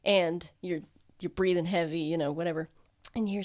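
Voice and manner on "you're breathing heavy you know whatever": ordinary delivery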